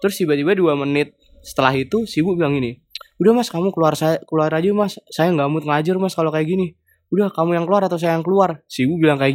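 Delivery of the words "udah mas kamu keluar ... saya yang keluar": higher-pitched mock scolding; imitated fed-up lecturer. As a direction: act out a teacher losing patience. Fed-up lecturer orders student out.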